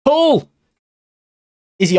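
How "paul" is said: sudden high yell of a name; cheering the striker on; excited shout. Real, not acted. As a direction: call the player's name out in a burst of excitement. Shouting at a player on screen.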